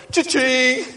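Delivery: high, sing-song cash register sound; playful "Cha-ching" till sound. Retold or imitated. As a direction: ring it up like a till.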